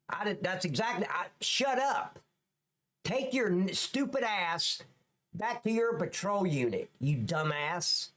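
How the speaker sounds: indignant, scornful rant at an officer; angry telling-off. Real, not acted. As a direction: scold with rising exasperation, drawing out the insults. Host angrily berates officer on video.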